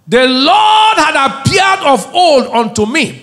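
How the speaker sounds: slow, high, loud shout; proclaimed scripture. Real, not acted. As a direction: boom the verse out over the music. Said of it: "reading scripture at full force".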